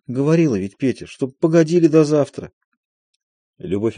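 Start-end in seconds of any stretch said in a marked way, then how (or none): none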